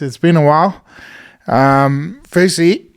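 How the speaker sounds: ordinary talk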